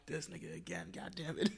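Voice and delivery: whispered grumble; annoyed mutter. Retold or imitated. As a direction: grumble it under your breath. Annoyed as partner arrives.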